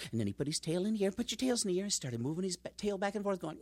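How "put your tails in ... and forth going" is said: usual speaking voice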